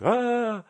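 high-pitched "aaah" cry; mock cry. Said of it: "так и закричит".

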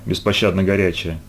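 plain reading voice

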